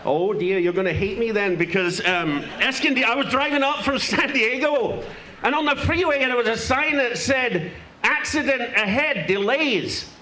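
mock Glaswegian comic voice, played broad; a lightly held Scottish accent; put-on Scottish accent. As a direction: talk like a brash Scotsman fresh off the plane. Holds a put-on Scottish accent until admitting he's London-born.

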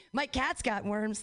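normal talking voice

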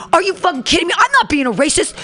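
high, outraged mock protest; imitated yelling. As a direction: act out an incredulous, heated denial. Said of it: "he starts yelling at Jonathan".

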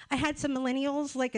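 normal talking voice

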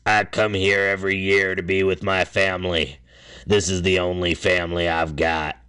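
imitated raspy hardcore camper; hoarse, croaky character voice; hoarse, rasping voice. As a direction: rasp it out like a rough old-hand camper. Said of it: "a hoarse, rasping voice".

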